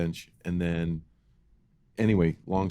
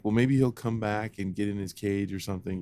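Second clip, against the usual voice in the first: ordinary talk, nothing marked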